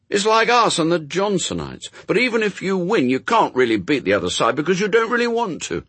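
put-on high, loud, indignant boy; Adam, protesting boy. A narrator's reading. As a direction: argue like a child who finds it all unfair.

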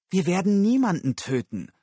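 loud, upset denial; upset retort. Acted. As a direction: snap back at him in outrage. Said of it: "gab Susan aufgebracht zurück".

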